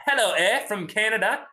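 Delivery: playful, slight British accent, lifted pitch; little British accent. Real, not acted. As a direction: greet with a hint of a British accent. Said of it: "I did a little British accent".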